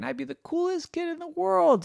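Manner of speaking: put-on high, starry-eyed child; high wishful kid voice; dreamy kid. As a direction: float it up high. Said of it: "said one of his friends".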